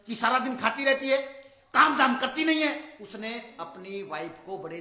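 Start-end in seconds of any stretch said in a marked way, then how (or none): 0.1-3.0 loud, higher furious shout; yelling at wife. Retold or imitated. Husband shouting at his wife from outside, loud. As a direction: bellow it from outside the house. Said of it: "बाहर से चिल्लाने लगा".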